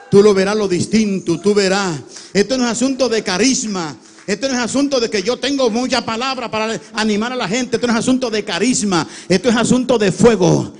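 high, very loud revival preaching; full-force preaching. Real, not acted. Preacher rousing the congregation about true fire, full-force shouting. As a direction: build into a shouted, impassioned sermon.

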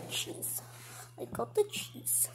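hushed, gleeful whispering; sneaky mouse whisper. Acted. Mouse creeping up on the cheese, whispering. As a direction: whisper it like a little thief.

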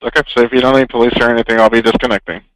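bored operator. Acted. Operator brushing off angry caller.

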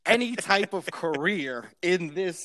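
slow, laughing self-mockery; laughing at himself. Real, not acted. Comic reading his old notes, laughing slowly. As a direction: laugh through it, slow and incredulous.

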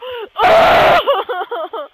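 high, loud quavering wail; real cry of frustration; wailing outburst. Real, not acted. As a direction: wail long and high, then fall. Reacting during play.

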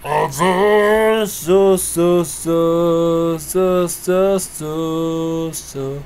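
very slow, drawn-out silly voice; comedian impression. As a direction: drag each word out like a goofy comedian. Mimicking comedian video voice. (芸人の真似で間延びした声)

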